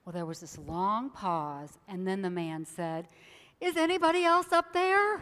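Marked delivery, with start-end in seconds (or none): 3.6-5.2 high, loud call with a laugh; hopeful shout again. Acted. Man refusing to let go, calling louder with a laugh. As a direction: shout up for anyone else.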